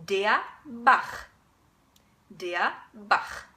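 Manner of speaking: Bach with a throaty ch at the end; German Bach. Shown as a demonstration. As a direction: say Bach with ach, not k. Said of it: "I'll give you a couple of examples".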